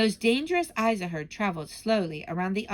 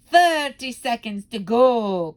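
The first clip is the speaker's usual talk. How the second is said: slow, very loud countdown cry; booming witch countdown; 3 semitones higher, 10 dB louder, slower than usual. Acted. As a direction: call the time out gleefully. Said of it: "cried the Grand High Witch".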